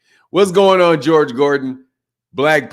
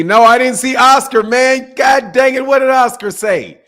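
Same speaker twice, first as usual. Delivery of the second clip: higher and louder, worked up; hyped reaction to news; excited disbelief; 10 semitones higher, 6 dB louder than usual. Real, not acted. Streamer asking chat what Oscar said, excited and high.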